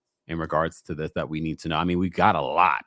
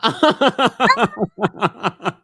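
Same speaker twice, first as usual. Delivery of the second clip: high, fast laughing giggle through words; squealing with laughter; 7 dB louder, faster than usual. Real, not acted. Laughing about leaders' resemblance.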